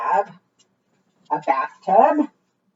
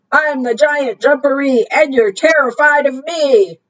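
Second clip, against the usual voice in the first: loud voice; 6 semitones higher, 4 dB louder than usual. Acted. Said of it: "came the loud voice".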